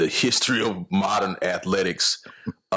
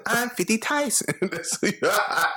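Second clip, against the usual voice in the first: laughing; 10 semitones higher than usual. Acted. Laughing while voicing.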